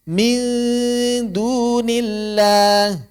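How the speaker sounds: slow chanted recitation; Quranic recitation. Shown as a demonstration. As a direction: recite it drawn out like tajwid practice. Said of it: "jangan nempel".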